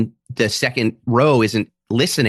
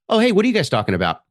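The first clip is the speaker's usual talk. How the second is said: bright, quick friendly question to a chatty table; cheerily pretend-curious, fast and light; nice way; 6 semitones higher, faster than usual. Comic to talkers mid-show, in a mock nice tone. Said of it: "In a nice way".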